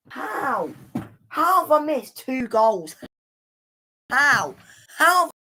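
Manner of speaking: high-pitched, incredulous questioning; exasperated disbelief. Real, not acted. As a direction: demand an answer in real dismay. Dismayed at missing two goals.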